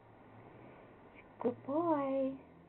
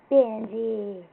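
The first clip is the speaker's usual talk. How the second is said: ordinary talk, nothing marked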